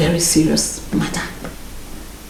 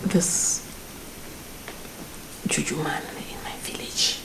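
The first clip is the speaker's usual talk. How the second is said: whispered secret; 3 semitones lower, 16 dB quieter than usual. Acted. Secret suggestion of juju man.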